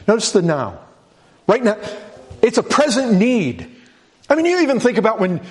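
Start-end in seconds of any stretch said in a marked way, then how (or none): 1.5-5.4 higher, pressing urgency; preacher rising with urgency; urgent preaching. Real, not acted. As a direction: push the urgency up high.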